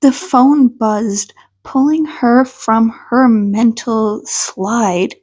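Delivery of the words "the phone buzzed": ordinary talk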